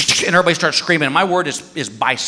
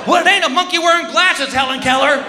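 high, sarcastic jab; snarky comeback; 8 semitones higher than usual. Retold or imitated. Husband insulting wife during game, snarky. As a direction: fire back a sarcastic insult. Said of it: "And I go".

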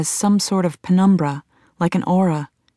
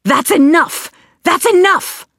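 high, forceful shout; 11 semitones higher, 5 dB louder than usual. A narrator's reading. Alice breaking up a struggle at the lab, shouting. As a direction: shout it sharply to end the struggle. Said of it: "Alice shouts".

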